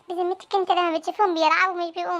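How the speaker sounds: normal talking voice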